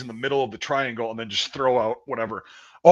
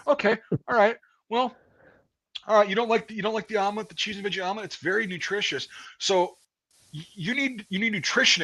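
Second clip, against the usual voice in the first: higher, sarcastic drill sergeant tirade; mocking drill sergeant; 6 semitones higher than usual. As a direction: chew him out with fake sweetness, then threaten. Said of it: "the drill sergeant, who's a Marine, he goes".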